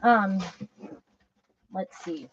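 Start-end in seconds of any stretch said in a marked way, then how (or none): 1.7-2.3 muttered to herself. Real, not acted. Musing to herself while sorting.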